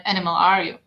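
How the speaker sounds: normal talking voice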